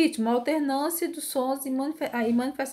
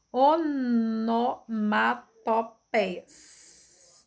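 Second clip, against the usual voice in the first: very slow, each syllable stretched; much slower than usual. Shown as a demonstration. Teacher introducing a long complex term, slowly syllable by syllable.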